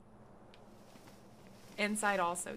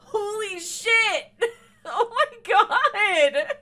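loud, slow, laughing shriek of shock; real startled scream with laughter; shocked shout; 7 semitones higher, 10 dB louder, much slower than usual. Real, not acted. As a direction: yell it out in stunned delight. Character falls unexpectedly.

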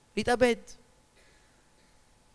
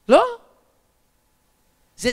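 ordinary talk

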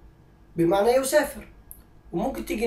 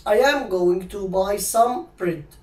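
very slow, every word spaced out; slow teacher dictation; slow model sentence; 3 dB louder, much slower than usual. Shown as a demonstration. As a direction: dictate it slowly to the class. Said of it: "انما تقدر تقول".

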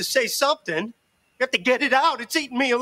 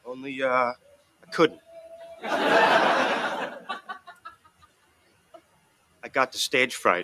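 low, halting admission; low-pitched, shamefaced confessing; ashamed confession; 10 semitones lower than usual. Acted. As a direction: confess haltingly, with long pauses.

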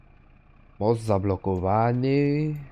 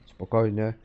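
ordinary delivery

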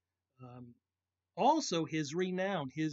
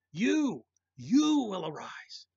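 the word You punched higher and louder, then slow; stress on YOU; 5 semitones higher, 9 dB louder, much slower than usual. Shown as a demonstration. Preacher stressing You to turn eyes toward God, slow and emphatic. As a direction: hit You hard, then draw out you will arise. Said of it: "is emphatic".

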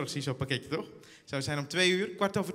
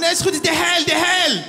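higher, louder insistent correction; mock shouting clerk; insistent operator shout; 10 semitones higher, 13 dB louder than usual. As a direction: shout the company name back.

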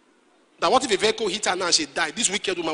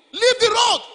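acted shouted order; 12 semitones higher, 6 dB louder, slower than usual. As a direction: imitate onlookers yelling, high and loud. Onlookers order child off road.

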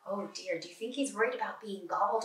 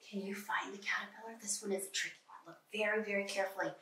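softer, leaning in to search the page; hushed searching; 3 semitones lower, 10 dB quieter, faster than usual. Real, not acted. Teacher hushing the class to hunt for the caterpillar, softer. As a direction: draw them in quietly to look.

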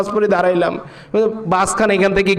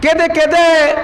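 impassioned sing-song sermon with long-drawn phrase endings; melodic preaching; 6 semitones higher than usual. Acted. Preacher telling the congregation of the Prophet and Ayesha, chanted preaching. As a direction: preach it in a ringing, sustained chant.

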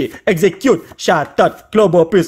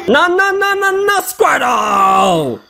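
frustrated protest; 5 semitones higher, much slower than usual. Real, not acted. Sees a bad card, protests.

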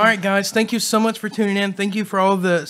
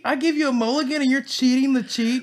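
playful accusation; 7 semitones higher than usual. Real, not acted. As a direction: call out the cheater, laughing. Teasing friend for dabbing off sauce.

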